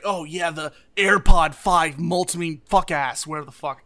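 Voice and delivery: slow, loud ad announcer parody; hammed-up product launch narrator; mock ad voice. Mocking Apple ad voiceover.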